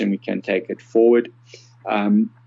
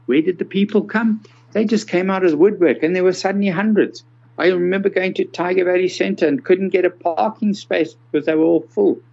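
excited; 9 semitones higher than usual. Real, not acted. Excited retelling of crowded parking.

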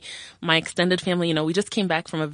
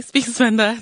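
ordinary delivery

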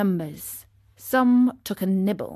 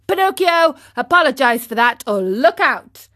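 loud, higher-pitched warning; threatening; 4 semitones higher, 7 dB louder than usual. Acted. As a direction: demand it as a threat. Boy threatens Pinocchio.

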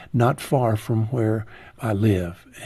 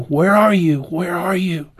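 raised, higher urgent call; retold yelling; 6 semitones higher, 5 dB louder than usual. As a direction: call out as if over a bad phone line. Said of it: "they were yelling".

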